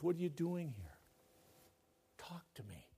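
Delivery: soft question fading to a whisper; God's still small voice. Acted. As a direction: coax him gently, then whisper. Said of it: "still small voice".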